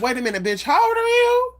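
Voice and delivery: high, shocked double take; sudden disbelief rising to a squeak; incredulous. Retold or imitated. Catching a pretender acting childish, she asks in high disbelief.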